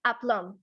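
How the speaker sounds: "एप्लम" ending on m, no b; silent B. Shown as a demonstration. Teacher modelling a listed word, "एप्लम" with silent final B. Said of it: "B that is going to be silent".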